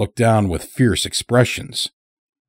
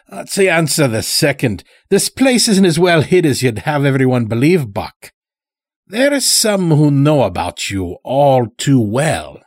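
put-on higher-pitched rustic old-timer; old farmer Morris, knowing; 6 semitones higher, 3 dB louder than usual. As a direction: play a folksy old captive who knows too much. Old captive farmer speaks in story.

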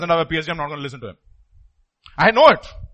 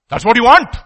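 loud accusation; 4 semitones higher, 9 dB louder than usual. Real, not acted. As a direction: punch out "That's what you want" loud and rising on want. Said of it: "repeated loud then soft".

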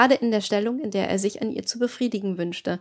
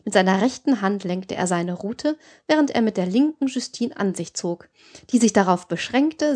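ordinary delivery

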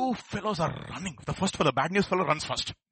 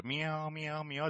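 cat meowing; 2 semitones lower, 10 dB quieter, much slower than usual. Acted. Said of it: "others were all cats".

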